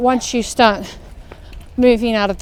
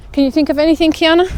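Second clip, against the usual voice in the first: calling out; 6 semitones higher, 5 dB louder, faster than usual. Real, not acted. Calling to daughter on walk.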